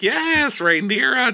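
high, drawn-up sarcastic outburst; mock exasperation. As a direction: scold in a high mocking pitch. Mock reaction to retold lie.

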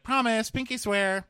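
put-on high childish pinky swear; mock childish promise. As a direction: promise it like a little kid. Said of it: "He's like".

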